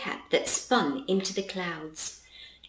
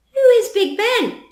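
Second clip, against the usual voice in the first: Charlie's voice; 14 semitones higher, 9 dB louder than usual. Acted. Charlie asks curiously.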